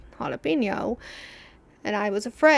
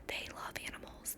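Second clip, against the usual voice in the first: whisper; 22 dB quieter than usual. Acted. Still whispering family gossip to viewers. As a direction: keep it secret, barely voiced.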